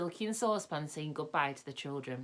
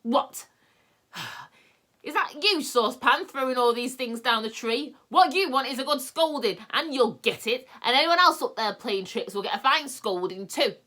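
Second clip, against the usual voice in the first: furious shout, much higher and louder; angry yell; 8 semitones higher, 8 dB louder than usual. A narrator's reading. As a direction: bellow at whoever threw the kettle. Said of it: "He gave a tremendous yell".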